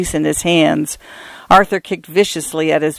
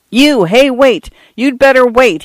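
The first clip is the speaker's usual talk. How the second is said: yelled; 7 semitones higher than usual. A narrator's reading. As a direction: shout him down, chasing. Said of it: "he yelled".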